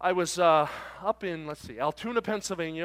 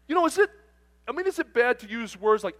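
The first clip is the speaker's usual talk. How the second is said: trucker character; 8 semitones higher, 8 dB louder than usual. Retold or imitated. Trucker asks preacher an awkward question.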